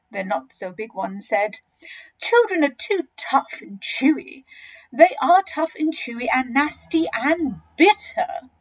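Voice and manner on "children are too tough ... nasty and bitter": higher voice, stressing each complaint; fussy crocodile turning down the food; not so big crocodile, disgusted. Acted.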